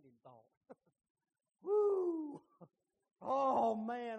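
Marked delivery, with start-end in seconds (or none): 1.6-2.4 high, loud laughing woo; relieved laughing whoop. Pretend. Man behind Aaron, grateful, whooping with laughter.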